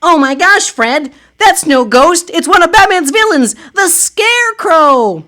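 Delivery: high, loud heroic Supergirl voice; a superheroine calling out in alarm; high heroine voice. Acted.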